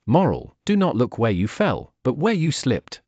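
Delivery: ordinary delivery, nothing marked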